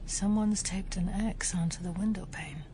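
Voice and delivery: whispery, quiet remark; hushed realisation. Acted. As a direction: say it under your breath as it dawns on you.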